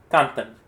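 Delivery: Cantan called out as CAN-tan; first-syllable stress. Shown as a demonstration. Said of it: "I'm going to say a word".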